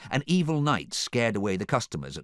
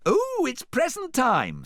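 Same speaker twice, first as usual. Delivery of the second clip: high, slow excited singsong; loud trilling squeal of delight; 5 dB louder, slower than usual. A narrator's reading. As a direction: sing it out with glee.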